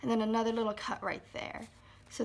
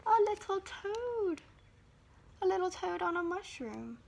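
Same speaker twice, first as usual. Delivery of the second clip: delighted reveal; 8 semitones higher than usual. Acted. Reveal to children.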